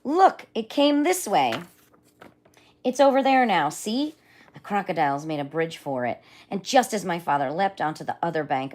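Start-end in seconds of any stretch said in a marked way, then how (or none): 0.0-4.2 high, loud urgent yell; wild boar scream. Acted. Wild boar alerting the others, loud high yell. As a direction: point and shout to the herd. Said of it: "he heard a wild boar scream".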